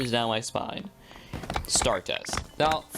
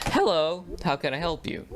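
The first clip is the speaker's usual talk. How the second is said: lightly voiced drive-thru worker, higher pitch; raised, sing-out customer-service hello; fast-food greeting; 6 semitones higher than usual. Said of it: "not the fast food way".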